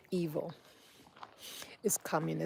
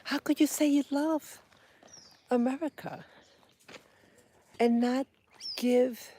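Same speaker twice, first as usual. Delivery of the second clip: loud, higher angry shout; angry outburst; 7 semitones higher, 5 dB louder than usual. Real, not acted. Ranting speaker shouts the question at the politician, angry and loud. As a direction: burst out with real anger. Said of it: "I'm angry".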